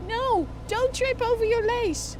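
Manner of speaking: high, slow, anxious plea; pleading. Acted. Commentator begs the courier not to trip, high anxious plea. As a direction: beg him not to trip.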